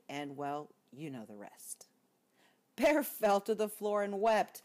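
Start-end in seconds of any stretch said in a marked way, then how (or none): 0.9-1.9 whispered aside. A narrator's reading. Narrator aside to listener. 2.8-4.6 dramatic narration. A narrator's reading.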